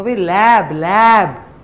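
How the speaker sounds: emphatic repeat. Retold or imitated. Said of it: "اتنی simple اس کو بات سمجھ نہیں آ رہی".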